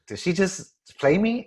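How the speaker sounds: incredulous, drawn-out comeback; mock offence. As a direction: act affronted, half laughing. Scripted reply to a slight.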